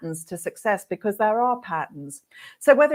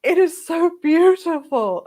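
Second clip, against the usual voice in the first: higher and louder, slow, overwhelmed with joy; moved young client; 9 semitones higher, 5 dB louder than usual. Retold or imitated. Stylist voicing her tearful client, slow high emotional.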